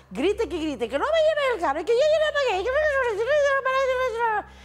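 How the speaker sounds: high, loud squawking mimicry; mock her as a frantic shrieking hen; gallina gritona. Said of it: "parecía una de esas gallinas". (imitando una gallina que grita sin parar)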